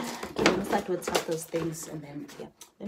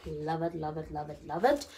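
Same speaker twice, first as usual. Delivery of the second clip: ordinary talk, nothing marked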